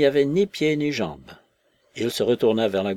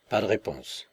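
usual speaking voice

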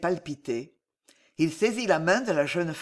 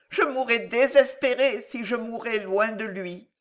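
higher and louder, wild passion; Alice's frenzied defiance; 8 semitones higher, 5 dB louder than usual. A narrator's reading. Alice rejecting the monk for her lover, frenzied. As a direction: refuse him with near-mad fervour.

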